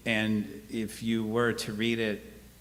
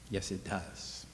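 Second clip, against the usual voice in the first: usual speaking voice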